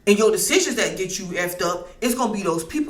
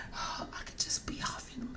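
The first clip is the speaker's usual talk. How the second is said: whisper; 20 dB quieter than usual. Pretend. Dreamy aside.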